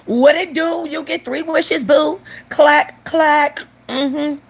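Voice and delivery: high-pitched sassy genie voice. Acted. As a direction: play the genie queen, high and punchy.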